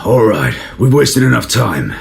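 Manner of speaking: loud, high officer's order; captain loudly cutting the talk short; commanding shout. Acted. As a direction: bark it out to end the meeting. Captain ends talk, gives order.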